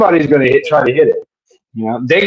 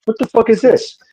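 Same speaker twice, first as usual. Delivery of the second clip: higher, mock-baffled alien squawk; baffled Martian; 8 semitones higher than usual. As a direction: play a confused Martian poking at a rover.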